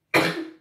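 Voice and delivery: sudden sneeze; sneezing. Real, not acted. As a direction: let a real sneeze out. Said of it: "j'ai éternué".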